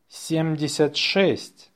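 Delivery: natural-speed dictation. Shown as a demonstration. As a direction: run the number together briskly.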